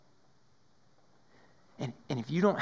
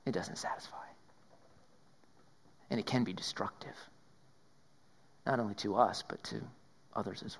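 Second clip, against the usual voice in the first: whisper; 6 semitones lower, 10 dB quieter than usual. Acted. Sobering point about pursued pleasure.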